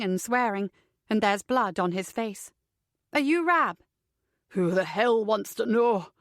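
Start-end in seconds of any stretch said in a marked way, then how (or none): 3.1-3.8 higher-pitched, insistent question; demanding question. A narrator's reading. Heroine questions pinned man. 4.5-6.1 slow, raspy Scottish retort; gruff Scottish accent. A narrator's reading. Scottish man answers back.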